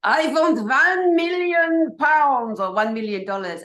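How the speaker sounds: mock Dr. Evil ransom demand; Dr. Evil impression. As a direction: savour the ransom demand like a cartoon villain. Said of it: "Mike Myers, like".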